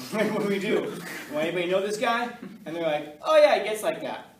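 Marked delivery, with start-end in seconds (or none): 0.1-2.3 1 panicked. Retold or imitated. Panicked teacher asks class. 3.2-4.4 1 mock class. Class answers casually.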